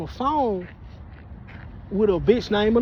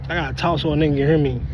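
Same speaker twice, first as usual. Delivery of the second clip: ordinary talk, nothing marked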